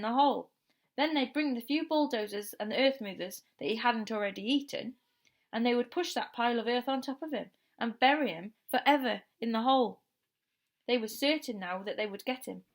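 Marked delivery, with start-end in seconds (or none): none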